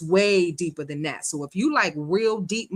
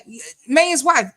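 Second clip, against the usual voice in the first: ordinary talk, nothing marked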